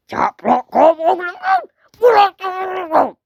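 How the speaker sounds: very high, loud urging; pretend shouting. Urging someone on in play, high drawn-out shout. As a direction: yell it high, stretching the last words.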